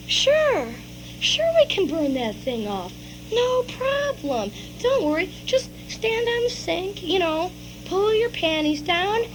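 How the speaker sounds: slow, hushed, sing-song reassurance; soft coaxing. Acted. Father coaxing child onto the sink, soft and slow. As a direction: draw it out softly, as if luring.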